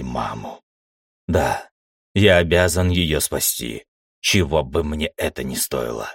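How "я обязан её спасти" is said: usual speaking voice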